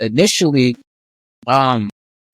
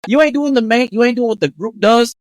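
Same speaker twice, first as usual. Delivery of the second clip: high, teasing disbelief; incredulous mockery; 7 semitones higher than usual. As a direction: tease him for not fitting in. Mocking incredulous peer, retold.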